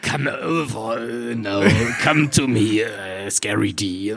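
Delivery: rattling dementor English; death rattle. Shown as a demonstration. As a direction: croak the words out slowly. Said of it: "dass das Englisch ist mit Röcheln".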